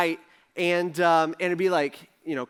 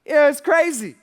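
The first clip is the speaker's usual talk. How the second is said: excited; 7 semitones higher, 7 dB louder than usual. Retold or imitated. Peter excitedly finishing his story.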